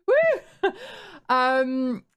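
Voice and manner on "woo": loud, high whoop; excited cheer. Real, not acted. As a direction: cheer like at a party. Cheering the joke.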